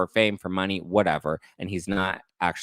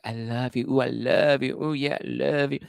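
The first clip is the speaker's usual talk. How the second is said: higher, cooing mock of his texts; mock lovey-dovey; 5 semitones higher than usual. Mocking his cheesy texts with a cooing lover voice. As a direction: coo it like a cheesy boyfriend. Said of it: "why is it so, like".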